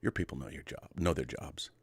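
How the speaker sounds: lightly voiced McCoy, hushed and measured, half-whispered on the pushing line; quiet, measured doctor warning his captain, lightly imitated; DeForest Kelley. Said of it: "when DeForest Kelley says".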